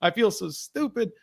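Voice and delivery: a light imitation of a flustered daughter; upset little girl. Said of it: "Freya's like".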